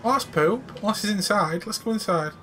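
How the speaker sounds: usual speaking voice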